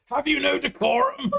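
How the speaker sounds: high, loud prim indignation; mock outrage. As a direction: scold like a prissy aristocrat.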